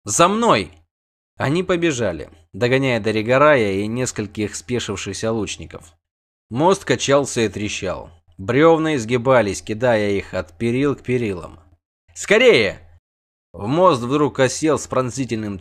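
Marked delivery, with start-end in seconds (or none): 0.0-0.7 higher rallying call; urgent call. A narrator's reading. Sorceress leads the dash to the bridge, urgent call. 12.1-12.8 high, loud cry to hurry; urgent shout. A narrator's reading. Hurrying companions over the cracking bridge, urgent shout.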